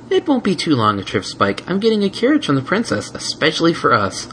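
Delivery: bright, sweet and persuasive; gentle mare voice. Acted. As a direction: sell the surprise. Twilight voiced.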